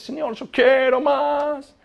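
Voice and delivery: agudo, lento, suplicante; ruego entre lágrimas. Retold or imitated. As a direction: suplica como llorando. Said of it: "lloraba y decía". (joven creyente de rodillas ruega llorando a Dios)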